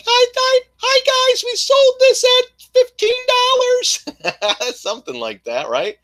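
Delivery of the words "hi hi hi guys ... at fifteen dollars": much higher, slow and louder, a sing-song fake cheer; mocking cheery voice. Mocking his rival's video greeting, sing-song high voice. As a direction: ham up a fake upbeat announcer. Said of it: "I'd be like".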